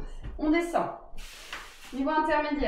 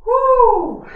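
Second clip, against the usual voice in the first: sharp high whoop of effort; loud effortful whoop; 11 semitones higher, 12 dB louder than usual. Real, not acted. Coach exhales during hard exercise.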